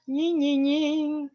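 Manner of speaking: high, soft "ying" sounds; playful whining noise. Pretend. Streamer teasing about a Brasileña, high mimicked noise. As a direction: imitate a high-pitched sing-song whine.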